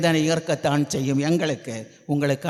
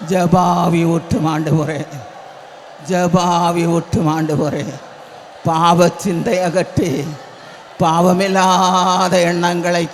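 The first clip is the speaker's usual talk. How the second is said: earnest pleading prayer; 2 semitones higher, 5 dB louder than usual. Real, not acted. Preacher asking the Lord to come with them, earnest prayer. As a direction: plead steadily, holding the ends of phrases.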